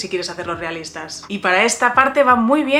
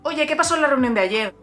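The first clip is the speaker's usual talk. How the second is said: casual, higher and livelier question; informal tone; 5 semitones higher than usual. Shown as a demonstration. Writer showing the same line said casually, informal tone. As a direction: toss it out as to a friend. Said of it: "con un tono más informal".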